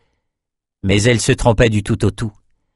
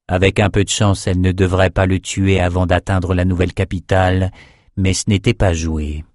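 usual speaking voice

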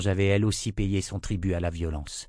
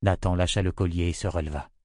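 plain reading voice

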